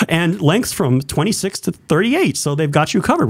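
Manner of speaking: upbeat radio ad read. Shown as a demonstration. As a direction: read it like a ballgame radio announcer. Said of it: "I do my radio voice".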